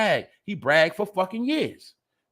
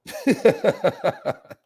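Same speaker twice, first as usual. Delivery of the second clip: high, rapid cackle; long burst of real laughter; amused laughing fit; 4 semitones higher than usual. Real, not acted. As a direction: crack up at how ridiculous it is. Laughing, finds it all hilarious.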